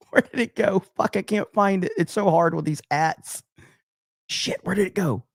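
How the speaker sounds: lightly voiced mock panic, searching for the name; mocking the chatter. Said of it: "He said".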